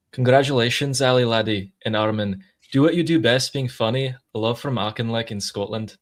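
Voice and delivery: put-on a light Scottish accent, mostly in her own voice. Reading a Scot's message live, in a Scottish accent. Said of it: "I'm gonna try and do my Scottish impression".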